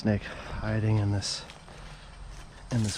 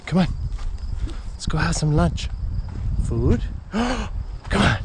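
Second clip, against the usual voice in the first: high, loud, eager call; rally the dog for lunch; excited calling; 7 semitones higher, 5 dB louder than usual. Real, not acted.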